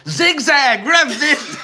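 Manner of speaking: very high, very loud joking holler; yelling back. Pretend. Co-host calling to the fleeing friend, yelling. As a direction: shout advice after a runner, half laughing. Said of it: "yell back to Jeremy".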